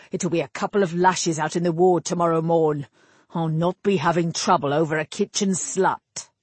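put-on annoyed old captain of the guard; weary, commanding rebuke; gruff captain. Captain figure voiced throughout.

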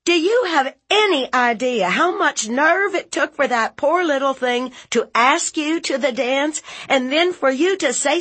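high, loud, rapid tirade; furious aunt. Acted. Aunt berating nephew for shaming a girl, furious. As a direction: tear into him at the top of the voice.

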